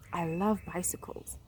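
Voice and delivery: soft whisper. Real, not acted. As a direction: breathe the words in a whisper. Whispered repeat.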